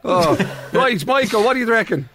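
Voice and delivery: ordinary talk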